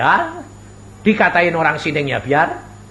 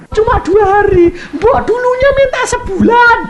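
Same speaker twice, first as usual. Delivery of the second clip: much higher, louder outcry; exclaiming; 7 dB louder than usual. Real, not acted. Preacher exclaiming to the congregation, high and loud. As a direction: throw it out in amazement.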